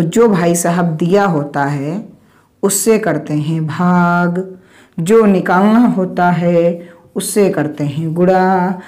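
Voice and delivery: drawn-out words, stretched line endings; slow rule recital. Shown as a demonstration. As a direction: recite it slowly like a rule to memorise. Teacher recites a rule as formula. (नियम रटाते हुए धीरे-धीरे खींचकर)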